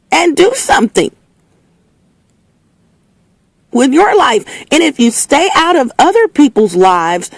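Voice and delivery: high, worked-up scolding; fired up. Real, not acted. Telling jealous listeners to get off their butt, fired up. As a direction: tell them off, honey, all riled up.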